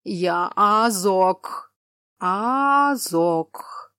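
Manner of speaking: very slow, loud cyclops voice; slow drawn-out speech. A narrator's reading. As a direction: stretch every word like a simple giant. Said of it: "Говорил он медленно, растягивал слова".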